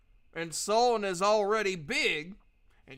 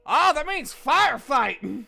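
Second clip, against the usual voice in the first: high, loud and slow delighted cry; shopkeeper's proud loud misreading; excited shout; 8 semitones higher, 9 dB louder than usual. Acted. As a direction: announce it with big glee. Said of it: "He's like".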